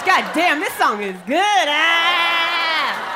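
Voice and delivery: high, drawn-out delight rising to a wail; ecstatic enjoyment. Acted. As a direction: give in to the song, hammed up. Outrage gives way to enjoyment.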